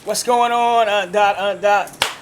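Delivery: booming, cheerful call; loud hearty greeting. Real, not acted. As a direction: call out to her warmly. Loud greeting to late aunt.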